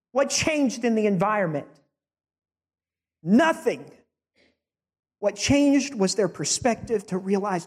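high, ringing rhetorical questions from the pulpit; preaching at full force. Acted. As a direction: drive each question home to the congregation.

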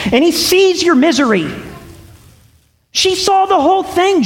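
high, rising preacher's call; impassioned pulpit preaching; fervent preaching. Acted. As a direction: press the point home to the congregation. Preaching climax.

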